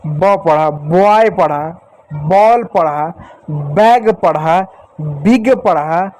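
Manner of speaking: slow, deliberate, drawn-out sounding of ब in each word; slow teaching demo. Shown as a demonstration. Teacher slowly sounding out ब for the children. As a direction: spell out each example word slowly for kids. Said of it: "इस बी को यहाँ पर आपने बी पढ़ा कि ब पढ़ा?".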